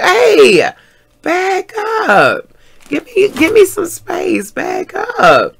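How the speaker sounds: high-pitched, stretched-out mock protest. Woman to man crowding her space, protesting. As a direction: call it out high, pushing him away.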